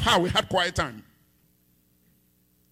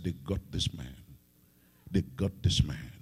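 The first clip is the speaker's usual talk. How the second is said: grim murmur; 8 dB quieter than usual. Real, not acted. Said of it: "grave aside to wife".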